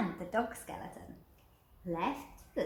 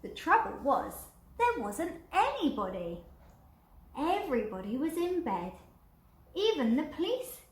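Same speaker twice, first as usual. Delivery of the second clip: higher and louder storytelling; dramatic telling; 6 semitones higher, 4 dB louder than usual. A narrator's reading. Narrator building suspense for children, higher. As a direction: play up the disappointment.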